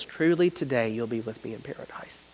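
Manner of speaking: low and very soft, almost breathed; hushed tender promise. Acted. Preacher voicing Jesus to the dying thief, hushed and tender. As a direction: give it like a gentle promise from the cross.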